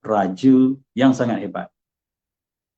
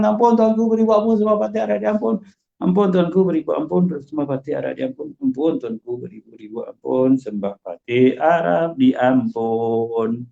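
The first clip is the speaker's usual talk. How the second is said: higher, rushed, then slowing mock plea; sloppy mumbled plea; 4 semitones higher than usual. Shown as a demonstration. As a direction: gabble it like a careless servant. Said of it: "Cuba buat macam tu kat istana".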